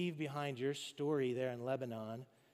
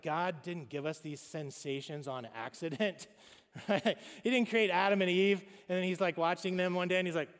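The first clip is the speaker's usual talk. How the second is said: ordinary talk, nothing marked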